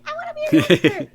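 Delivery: put-on high, loud little-kid squeak; tiny squeaky voice at full volume. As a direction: squeak it out like an eager young fan. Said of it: "in my squeaky little voice".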